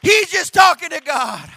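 high, loud proclamation; shouted punchline. Real, not acted. Preacher repeating the workers' answer, shouted proclamation. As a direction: ring it out to the congregation.

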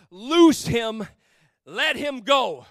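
slow, measured scripture reading; solemn reading. Shown as a demonstration. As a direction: read the verse slowly and weightily. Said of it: "The scripture says it like this".

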